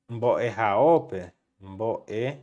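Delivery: "mbo e haope" said slowly, syllable by syllable; slow model phrase. Shown as a demonstration. (dito devagar, sílaba por sílaba)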